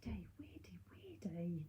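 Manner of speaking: playful creepy whispered chant; spooky whisper. Pretend. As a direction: breathe it like a ghost story.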